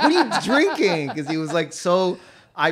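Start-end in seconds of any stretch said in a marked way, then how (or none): 0.0-1.1 high, loud, laughing; laughing disbelief. Retold or imitated. Rami asking his co-star what he drank, high, loud and laughing. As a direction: call it out through laughter. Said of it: "Rami's like".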